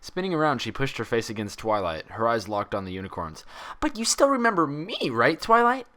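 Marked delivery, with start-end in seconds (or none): 3.8-5.9 high, frantic bubbly pony; frantic urgency. Acted. Pinkie pressing Twilight to remember her, frantic. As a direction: plead fast and anxious. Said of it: "with frantic urgency".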